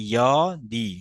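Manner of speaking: slow, drawn-out ইয়দি, opening on a Y sound; pronunciation demo. Shown as a demonstration. Teacher correcting how ja is read, slow ইয়দি with Y. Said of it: "জর উচ্চারণ ইয়র মতো উচ্চারিত হয়".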